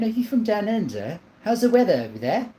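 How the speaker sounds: playful put-on Australian accent, lightly done; mock Australian accent. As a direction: greet a chatter from down under in a jokey Australian accent. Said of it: "Your accent is point on".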